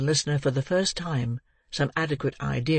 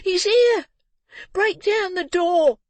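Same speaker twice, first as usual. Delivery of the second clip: high, loud screams, slow on the door; screaming boy; 6 dB louder, slower than usual. A narrator's reading. Boy screaming to the crowd outside, high and loud. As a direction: scream it through the house.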